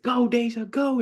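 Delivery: root her on down the stretch; cheering. Retold or imitated. Cheering sister in race.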